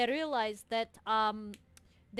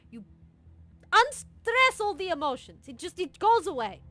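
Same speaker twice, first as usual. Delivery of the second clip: loud, high commanding pep talk; loud bossy coach; 7 semitones higher, 10 dB louder than usual. Acted. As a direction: bark orders like a coach. Coach-like sister orders.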